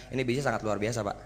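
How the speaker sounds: low, monotone and unenthused; flat delivery. Shown as a demonstration. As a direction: say it with no excitement at all. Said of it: "semangat atau datar gitu". (datar tanpa semangat)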